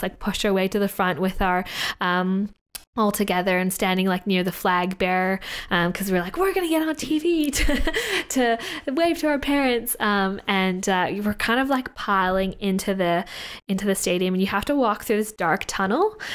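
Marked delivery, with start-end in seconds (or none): 6.4-10.0 excited teammates. Retold or imitated.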